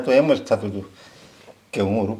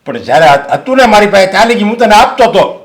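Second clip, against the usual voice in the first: higher and louder, hurling accusations; angry shouting; 12 semitones higher, 14 dB louder than usual. Acted. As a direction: rant at her, blaming everything on her. Father keeps shouting blame.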